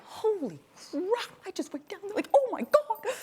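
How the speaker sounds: high, hushed disbelief; stunned awe. Retold or imitated. Looking up at the cliff, stunned and awed. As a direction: marvel at what you just did.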